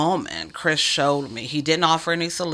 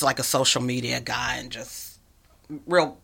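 ordinary delivery

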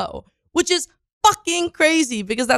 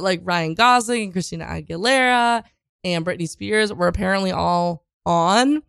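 ordinary delivery

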